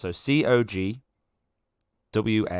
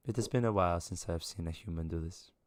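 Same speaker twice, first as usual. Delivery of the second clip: lightly voiced aloof figure, softer; a touch of detached observer, quieter; aloof non-human woman; 3 semitones lower, 8 dB quieter than usual. She watches a human faint, lightly voiced aloof remark.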